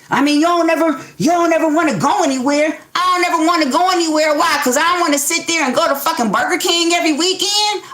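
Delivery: exasperated partner. Acted. A fed-up partner mocks the other's complaint, ranting. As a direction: throw their complaint back at them.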